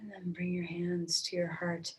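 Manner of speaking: breathy whispered cue. Acted. Teacher whispering a cue to the resting class. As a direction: whisper it like a secret. Teacher guiding quiet reflection.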